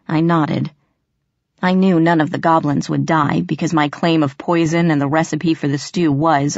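usual speaking voice

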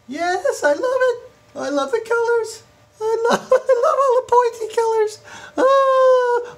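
squealing falsetto rapture; giddy ecstasy. Acted. Faux tycoon adoring the pointy colors, giddy ecstasy. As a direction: gush in a very high, giggly falsetto.

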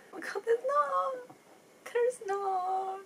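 high-pitched, crying disbelief; tearful wreck. Real, not acted. Viewer finding no end credit scene, tearful high wail. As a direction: wail it softly, very high, near tears. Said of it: "I'm a little bit of a wreck".

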